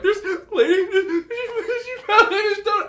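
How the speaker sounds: very high, hysterical laughing speech; gasping the words out while cracking up; squeaky words through helpless laughter; talking through laughs. Retold or imitated. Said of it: "talking through laughs".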